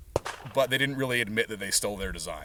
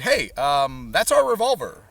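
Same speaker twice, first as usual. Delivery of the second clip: indignant protest; 3 semitones higher, 6 dB louder than usual. Retold or imitated. Company protesting its stolen design.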